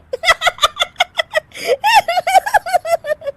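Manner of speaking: long, high-pitched laughing fit; uncontrollable laughter. A narrator's reading. Daughter-in-law laughs at a guest's soiled kurta, helpless laughter. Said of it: "Anshu फिर से हंसने लगती है".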